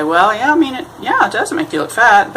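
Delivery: a wife stating the truth, lightly voiced; blunt honest wife. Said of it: "the wife would say".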